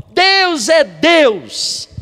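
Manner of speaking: full-force preacher's declaration; preaching emphasis. Acted. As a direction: proclaim it slowly to the church. Preaching climax after 111.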